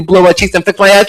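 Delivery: usual speaking voice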